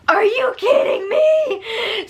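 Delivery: high, slow laughing half-shout; outraged. Real, not acted. Reacting to Jackson for viewers, real outrage. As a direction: cry it out in exasperated disbelief.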